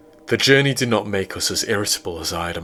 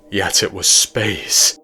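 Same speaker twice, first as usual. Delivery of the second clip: ordinary delivery, nothing marked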